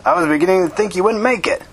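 high, happy exclamation; happy cry. A narrator's reading. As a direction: welcome a friend with lifted pitch. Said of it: "he cried with happiness".

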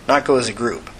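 usual speaking voice

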